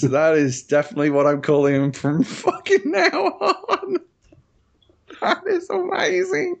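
talking through high, squeaky laughter; breaking up laughing mid-sentence; real helpless laughter. Real, not acted. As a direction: crack up until the words barely get out. Cracking up at misspelt name tweet.

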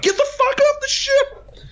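high shrieking yell to get off; panicked yell. Retold or imitated. Crewmates yelling at the player to get off the ship, high panicked shriek. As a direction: screech it in mock panic. Crew panics at crying chest.

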